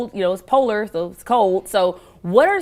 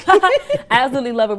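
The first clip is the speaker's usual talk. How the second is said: laughing, louder and brighter; 6 semitones higher, 7 dB louder than usual. Real, not acted. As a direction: burst out laughing while speaking. Laughing host reacts.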